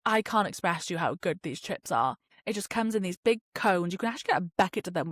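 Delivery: usual speaking voice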